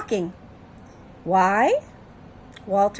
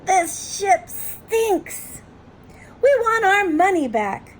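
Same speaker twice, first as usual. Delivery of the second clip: high-pitched, drawn-out outraged complaint; hammed-up high protest; angry passengers; 9 semitones higher, slower than usual. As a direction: complain like indignant cruise passengers.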